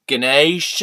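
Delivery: "Ganesh" with a long AY in the second syllable, loud; long-vowel Ganesh. Shown as a demonstration. Introducing his friend, "Ganesh" tried out as guh-NAYSH. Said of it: "adding extra syllables for me to say".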